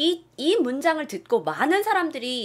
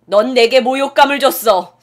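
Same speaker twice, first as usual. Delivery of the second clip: mock dramatic line; 10 dB louder than usual. Mock movie line quote.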